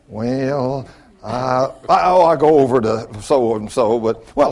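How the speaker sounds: mock reluctant answer with drawn-out hesitations; evasive stalling. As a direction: act out a sheepish dodge.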